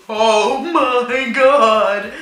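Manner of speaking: mortified drawn-out oh my God; dismayed gasp. Retold or imitated. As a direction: stretch it out in horror. Seeing the blood-stained bed.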